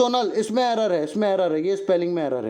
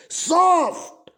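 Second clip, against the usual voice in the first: Soft drawn out slow, higher and louder; stretched high; 8 semitones higher, 3 dB louder, much slower than usual. Shown as a demonstration. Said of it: "बोलो कैसी".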